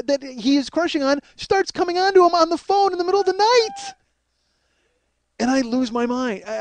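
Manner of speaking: usual speaking voice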